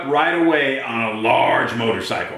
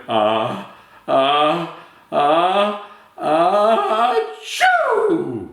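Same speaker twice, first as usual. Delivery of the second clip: uh uh uh rising higher to a big achoo; building sneeze; 6 semitones higher, much slower than usual. Acted. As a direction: wind up and let the sneeze explode. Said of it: "Andrew went".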